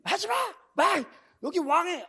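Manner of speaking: very high, loud shouts to stop someone; high-pitched acted yell; alarmed shouting servant. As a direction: imitate a frantic guard yelling out a warning. Acting servants barring the way.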